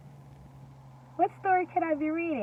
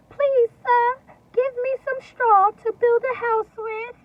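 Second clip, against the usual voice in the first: much higher, loud squeaky piglet; little pig voice; 11 semitones higher, 8 dB louder than usual. Acted. First little pig begging the straw man, high and loud. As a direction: ask politely in a tiny pig voice.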